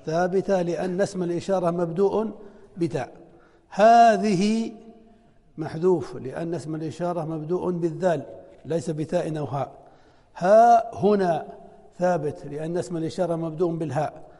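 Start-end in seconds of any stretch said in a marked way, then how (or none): 3.7-4.7 drawn-out example word. Shown as a demonstration. Teacher reads example word to students, slow and louder. 10.4-11.5 drawn-out example word. Shown as a demonstration. Teacher reads example word to students, slow and louder.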